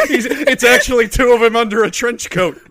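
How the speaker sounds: laughing loudly through the words. Real, not acted. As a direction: crack up while saying it.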